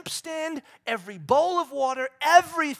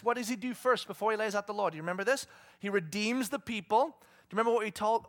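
ordinary delivery

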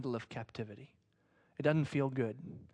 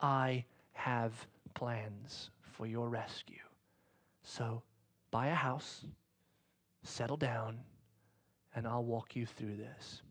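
slow, soft voiceless whisper; God whispering comfort, slow and soft; 4 semitones lower, 8 dB quieter, slower than usual. Acted. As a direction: whisper it slowly to one listener. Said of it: "may God whisper to you today".

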